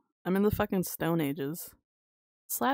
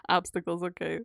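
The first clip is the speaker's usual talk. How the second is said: ordinary talk, nothing marked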